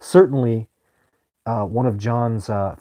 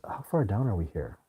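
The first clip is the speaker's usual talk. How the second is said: ordinary talk, nothing marked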